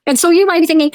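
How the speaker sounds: ordinary talk, nothing marked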